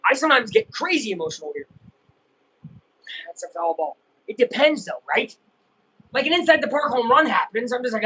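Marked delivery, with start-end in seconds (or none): none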